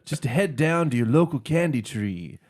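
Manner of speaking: loud folksy singer's pitch; [name] impression. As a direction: belt it like a folk troubadour. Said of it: "my Dylan impression".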